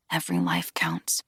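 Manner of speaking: breathy hushed inner voice; soft private whisper; whispered thought. A narrator's reading. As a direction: whisper it to yourself like a creed. Whispered inner Jedi maxim.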